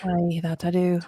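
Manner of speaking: light Irish lilt on aye; a touch of Irish brogue; mock Irish accent. Friend answers in a playful Irish accent. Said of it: "need them to be Irish".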